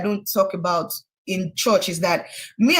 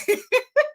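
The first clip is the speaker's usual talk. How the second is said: a burst of high-pitched laughter; sudden high laugh. Real, not acted. Laugh after retold threat.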